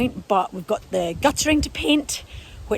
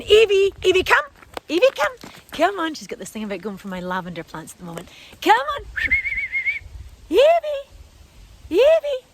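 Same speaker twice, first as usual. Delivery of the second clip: calling the dog; 11 semitones higher than usual. Real, not acted. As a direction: shout her name to stop her.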